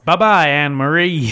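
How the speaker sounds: hammed-up pilot farewell. Pilot bailing out, calling goodbye to sweetheart loudly. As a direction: wave her off as you bail out.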